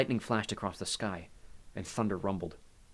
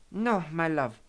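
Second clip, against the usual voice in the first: put-on higher voice with an Italian accent; 7 semitones higher, 4 dB louder, slower than usual. A narrator's reading. Maria refuses Hades, in an Italian accent. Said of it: "she spoke with an Italian accent".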